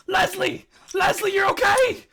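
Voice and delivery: high, very loud cry of relief; excited shout, voice leaping up high. Acted. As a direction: call her name out across a crowd. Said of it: "you hear shouts like".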